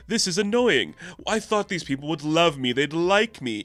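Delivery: lightly hammed-up hurt pride; wounded Thor. Thor complaining that protesters should love him, mock wounded. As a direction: play a mock-aggrieved, self-important hero. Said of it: "They get so mad at him, and he's like".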